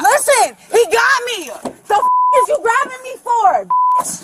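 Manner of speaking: slurred, belligerent yelling. Real, not acted. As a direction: pick a fight, words slurring. Confronting officer during arrest.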